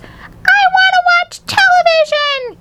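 very high, drawn-out falsetto shriek; tiny boy throwing a tantrum; squeaked tantrum. A narrator's reading. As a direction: squeal it in a fit. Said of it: "he squeaked".

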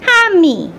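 put-on high, childish mispronunciation; little toddler voice. Toddler repeating the hamster's name, high little voice. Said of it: "a little voice called out".

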